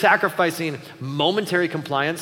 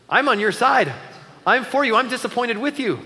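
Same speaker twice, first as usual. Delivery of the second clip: earnest reassurance; 7 semitones higher, 4 dB louder than usual. Pretend. Dad reassuring grounded kid.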